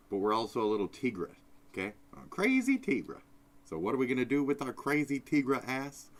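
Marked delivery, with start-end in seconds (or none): 2.1-3.2 playful. Acted. Hyping himself up.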